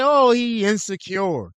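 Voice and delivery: slow, put-on whiny excuse voice; quoting women's excuse, slow and whiny; mocking women's excuse. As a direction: drag it out in a sneering mock tone. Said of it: "So y'all could try to say".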